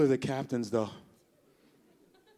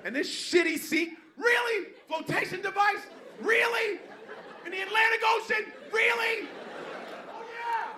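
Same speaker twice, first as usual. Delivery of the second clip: high shrieking panicked rant; frantic passenger. Retold or imitated. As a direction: scream in disbelief while struggling with the vest.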